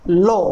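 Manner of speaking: लो said as a long lo with a rounded o; correct pronunciation lo. Shown as a demonstration. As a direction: say लो with a clear long o. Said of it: "इनका pronounce सो". (लो को लंबे गोल ओ के साथ बोलें)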